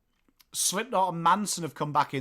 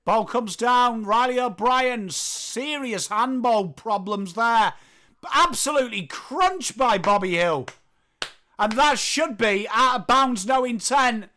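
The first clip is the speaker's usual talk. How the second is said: lively, raised-pitch footy commentary; play-by-play; 7 semitones higher, 4 dB louder than usual. Acted. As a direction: keep calling the action with urgency.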